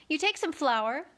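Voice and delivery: plain reading voice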